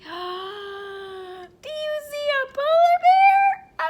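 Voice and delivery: gasp, then loud high squeal; hammed-up amazed discovery, drawn out; mock astonishment. Mock surprise at animal appearing.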